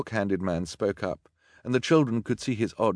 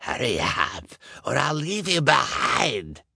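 slow threat climbing to a high call; impatient villain; 6 semitones higher, 3 dB louder, slower than usual. A narrator's reading. Villain calls to his gang from the car, threatening. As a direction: threaten them, voice rising.

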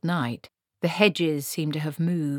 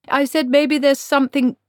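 much higher and louder; insistent repeat; 10 semitones higher, 8 dB louder than usual. A narrator's reading. Wizard repeating himself after thunder interrupts, loud and insistent. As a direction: raise your voice over a storm. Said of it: "he began again".

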